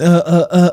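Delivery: "uh, uh, uh" as repeated, separate hesitation noises; mimicked filler pauses. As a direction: mimic a halting speaker with "uh, uh, uh". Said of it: "these pauses and".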